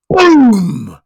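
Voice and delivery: loud, high mock rifle boom. Telling the bear joke, a loud mock rifle blast. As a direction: bellow the boom like a big gun.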